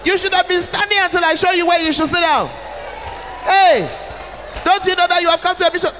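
high, loud scolding shouts of Hey; rude shouting. Shown as a demonstration. Bishop's wife rudely yelling at a guest in the chair. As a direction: bark at the guest to get out of the chair. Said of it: "I won't say it in a way that".

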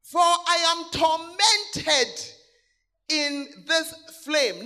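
very slow, drawn-out lament; tormented. Acted. Rich man in hell crying out, slow anguished lament. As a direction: stretch each word like a man in pain. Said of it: "The guy was feeling the torment".

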